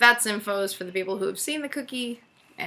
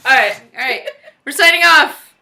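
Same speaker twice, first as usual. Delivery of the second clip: loud laughing sign-off; 7 semitones higher, 15 dB louder than usual. Real, not acted. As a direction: laugh through the words. Laughing while signing off.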